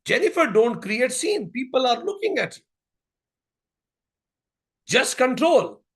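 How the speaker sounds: lightly acted exasperated mother; retold mother's scolding. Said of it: "Mother again said".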